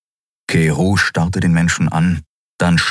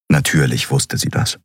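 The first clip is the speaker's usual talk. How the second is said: usual speaking voice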